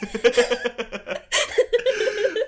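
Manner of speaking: loud high burst of laughter. Real, not acted. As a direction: crack up loudly. Big laugh at story.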